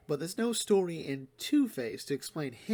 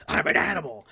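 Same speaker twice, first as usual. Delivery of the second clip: loud defiant declaration; animal declaration; 2 semitones lower, 8 dB louder than usual. Retold or imitated. Penguin answering Umbrella Man, loud defiant snarl. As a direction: declare it loudly like a beast unleashed. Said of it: "And Penguin just goes".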